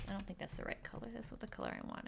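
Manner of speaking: very soft, quick self-talk; muttered aside. Real, not acted. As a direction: mumble it quickly to yourself. Muttered aside while drawing.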